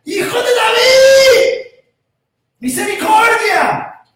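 high, loud, drawn-out plea; shouting. Acted. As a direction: cry out slowly for mercy. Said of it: "A gritos".

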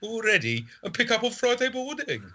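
mock-formal, exaggerated Brummie; sophisticated Brummie. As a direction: press the seller politely in thick Brummie. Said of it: "I'm going sophisticated Brummie".

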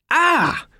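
high startled squawk of alarm. A narrator's reading. Howard startled while leaping, squawking. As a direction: yelp like a startled bird. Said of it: "squawked Howard".

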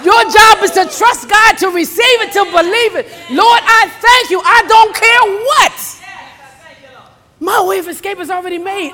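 high, loud, driving exhortation; full-force preaching. Real, not acted. Preacher rousing the saints to trust God, fervent.

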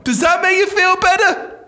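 very high-pitched mock yell; sarcastic outburst. Shaved-head do-gooder to suicidal friend, sarcastic high yell. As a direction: shriek it as a triumphant, sarcastic question. Sarcastic climax of acted skit.

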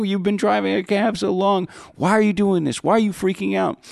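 high-pitched, incredulous retold plea; exasperated pleading. Passenger talking down the cab driver, pleading. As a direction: reason urgently with someone losing it.

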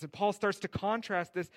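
ordinary talk

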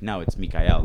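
firm correction with "Mikael" ending on a stressed EL; corrected name. Retold or imitated. Man correcting people who call him Michael, stressing the EL of "Mikael". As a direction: say "Mikael" in three syllables, mee-kai-EL. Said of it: "And I'll be like".

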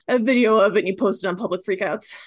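ordinary talk, nothing marked

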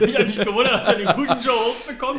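talking through laughter; words breaking into laughs; laughing. Real, not acted. Joking about a wrestler.

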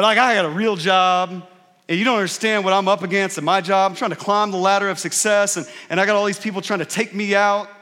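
loud, fast, whiny complaint about the boss; hammed-up whining about a real job; mock defensive rant. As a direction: rant defensively at your mom.

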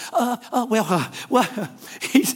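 slow, stammering imitation of a caught-out man; flustered false starts; verbal backpedaling. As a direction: hem and haw, hunting for words. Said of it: "Backpedaling verbally".

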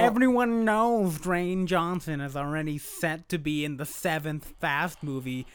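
slow, drawn-out troll voice; laboured internet-troll correction. Acted. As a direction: correct them slowly as a know-it-all troll. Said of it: "that's my internet troll voice".

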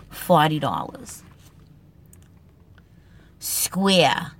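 normal talking voice

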